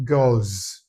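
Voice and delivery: put-on "girls" drawn out with a final z; voiced plural ending. Shown as a demonstration. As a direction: stretch "girls" slowly into a buzzing z. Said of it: "when you add S to it".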